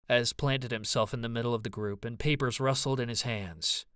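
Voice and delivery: normal talking voice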